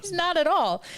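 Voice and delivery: laughing through the words; giggling denial. Real, not acted.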